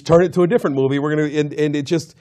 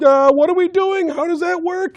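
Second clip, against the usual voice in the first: dim coworker. Retold or imitated. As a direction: play the clueless teammate, pitch way up. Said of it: "one of her coworkers on the team suddenly was like".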